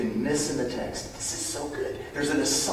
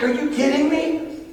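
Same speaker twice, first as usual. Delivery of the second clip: disbelief; 4 dB louder than usual. Retold or imitated. Incredulous reply to the plea.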